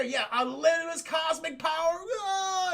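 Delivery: high, loud boast rising into a cry; mock power-mad cry. Villain gloats over his cosmic power, mock power-mad cry. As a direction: let it climb into a mock scream.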